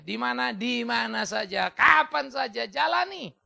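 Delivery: high, insistent shouted exhortation; rallying preaching. Real, not acted. Preacher rallies congregation with refrain.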